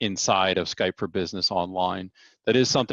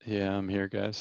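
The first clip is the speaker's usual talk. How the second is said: faint, cutting-out audio; tape dropouts; 7 dB quieter than usual. Real, not acted. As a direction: voice fading on a bad line. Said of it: "audio keeps cutting out here".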